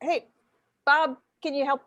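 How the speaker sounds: ordinary talk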